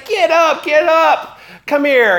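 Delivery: the prince waving bowing people up, loud and eager; eager welcome. Retold or imitated. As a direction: pull them up like an old friend. Absalom lifting up bowing people.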